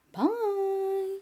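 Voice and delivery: high, held-out "bye"; sing-song goodbye. Real, not acted. Reader signs off to viewers, sing-song goodbye. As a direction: let the farewell ring out high and long.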